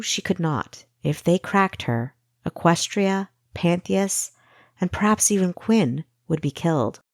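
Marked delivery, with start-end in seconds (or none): none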